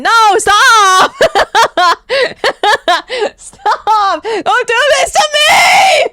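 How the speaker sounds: high, loud wail through laughter; mock wailing. Streamer begging a chatter not to shave, mock wailing. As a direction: cry out in hammed-up anguish.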